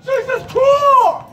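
full-force cry to heaven; top-of-the-lungs scream. Retold or imitated. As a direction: scream the name out long and high. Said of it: "I scream at the top of my lungs".

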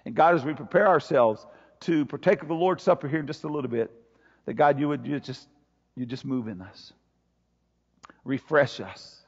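low, hushed pastoral prayer; earnest prayer. Real, not acted. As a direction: pray softly and low, letting it sink toward the amen. Leads congregation in prayer.

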